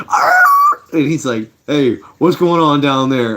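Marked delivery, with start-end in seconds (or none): 0.1-0.4 very high, loud dog squeal; sudden piercing imitated yelp. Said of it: "you hear". 1.7-3.4 slower, raised voice calling downstairs; lightly acted call, pitched higher; mock calling down. Dude calls from upstairs in story.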